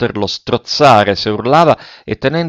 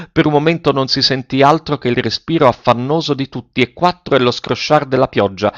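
usual speaking voice

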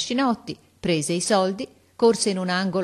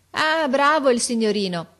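sick mother; 8 semitones higher, 7 dB louder than usual. Acted. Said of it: "mi disse la donna".

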